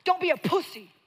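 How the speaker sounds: loud, high, barking order; mentor's harsh yell. Retold or imitated. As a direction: yell it like a hard-driving mentor. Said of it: "she ran the play of".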